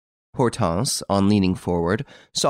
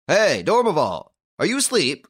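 calling through door; 8 semitones higher than usual. A narrator's reading. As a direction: call out to wake a sleeper. Thumping on cabin door.

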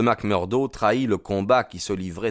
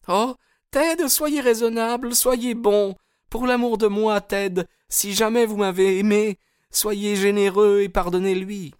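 high, louder desperate begging; distraught pleading; 5 dB louder than usual. A narrator's reading. Hetty begging Ted to forgive him, distraught. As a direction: implore him in a high female voice. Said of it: "la pauvre Hetty bouleversée".

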